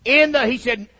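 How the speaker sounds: builds to a booming shout; preaching shout. Acted.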